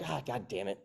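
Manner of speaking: softly grumbled, acted curse; muted annoyance. Coach voicing annoyance at seal walks, grumbling softly. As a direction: curse under your breath, mock annoyed.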